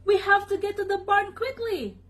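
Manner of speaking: high-pitched worried sheep; bleated alarm. A narrator's reading. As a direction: urge them on in a high bleat. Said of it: "the sheep bleated".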